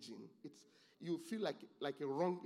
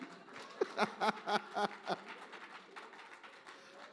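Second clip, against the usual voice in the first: long, loud run of laughter; hearty laughing; 7 semitones higher, 3 dB louder than usual. Real, not acted. Preacher amused that mostly ladies are clapping, laughing. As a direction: laugh at length in steady bursts.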